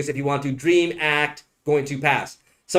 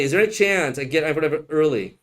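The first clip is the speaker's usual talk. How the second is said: ordinary talk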